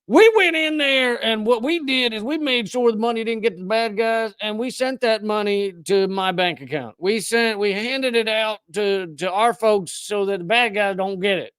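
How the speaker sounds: high-pitched mock Southern soldier; Southern accent. U.S. military man excusing stolen money, mock Southern accent. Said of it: "I'm gonna do a Southern accent".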